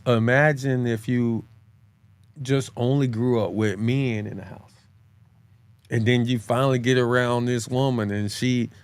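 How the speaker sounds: normal talking voice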